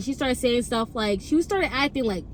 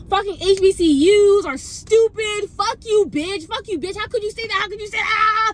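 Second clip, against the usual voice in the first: whiny exaggerated fury, higher in pitch; hammed-up outrage; 6 semitones higher, 5 dB louder than usual. Imitating a friend raging about HBCUs, hammed-up outrage. Friend's angry texts acted out, louder, wailing.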